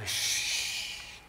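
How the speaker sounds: soft unvoiced shush; gentle shush. Jesus gently hushes the weeping widow, whispered. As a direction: hush her tenderly. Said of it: "na-imagine ko na ang sabi niya".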